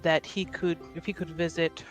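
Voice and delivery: usual speaking voice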